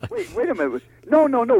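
mock alarm at the misunderstanding; startled correction. Garage president stops him from paying, startled.

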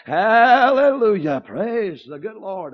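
usual speaking voice

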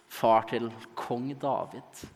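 ordinary delivery

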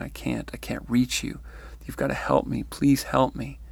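usual speaking voice

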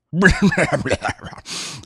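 put-on hearty old man's chuckle; wrinkled laugh. A narrator's reading. Lord Pabham laughing at Clovis's scheme, amused chuckle. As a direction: break into a wheezy laugh. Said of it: "his face broke into a wrinkled network of laughter".

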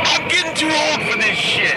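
Danny Glover. Pretend. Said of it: "it's Danny Glover".

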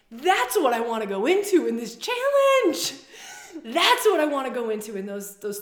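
laughing through the words with excitement. Real, not acted. To her listeners about the challenge, laughing. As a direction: let a laugh bubble up as you announce it.

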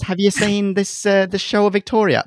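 badly mimicked accent, pitched high; Marie. Teasing co-host about not seeing Victoria, badly imitated accent. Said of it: "That's not what you sound like, by the way, Marie".

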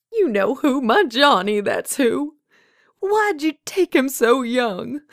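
high, loud, grief-stricken accusation; anguished outburst. A narrator's reading. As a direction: pour out old grief at the one who took him. Old woman confronting Death over husband.

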